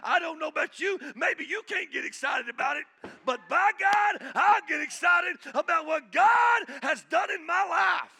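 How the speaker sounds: loud, high, shouting preacher; fiery revival shout; full-force preaching. Acted. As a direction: preach it out to the whole church at full volume.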